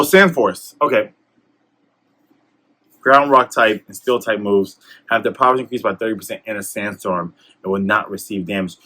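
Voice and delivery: ordinary talk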